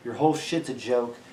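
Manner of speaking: normal talking voice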